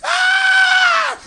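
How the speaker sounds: very high, loud scream; high loud screaming cry; piercing scream. Retold or imitated. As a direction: let out a wild shriek. Comic scream in bit.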